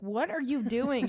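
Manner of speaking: high, loud, drawn-out disbelief; mock scolding. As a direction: take mom to task in mock alarm. Daughter scolds her drinking mom, retold.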